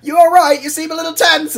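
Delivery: high, pained yelps into timid question; alarmed. Acted. Battered truck checking on its raging leader, alarmed.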